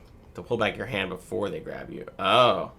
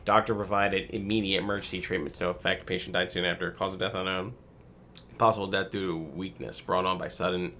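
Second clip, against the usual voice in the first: ordinary talk, nothing marked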